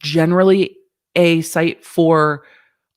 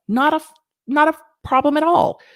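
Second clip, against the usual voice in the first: exaggerated; 11 semitones higher than usual. Real, not acted. Exaggerated repeat.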